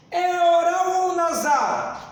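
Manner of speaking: quiz shout. Real, not acted. Teacher quizzing exam students, calling the question out loud. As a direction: shout the question to wake up the room.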